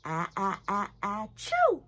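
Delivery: a muffled sneeze into a hanky. Shown as a demonstration. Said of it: "Some people sneeze into a handkerchief".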